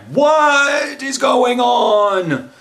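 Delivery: slow high panicked shout. Retold or imitated. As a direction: call it out high and stretched. Emergency saucer separation panic.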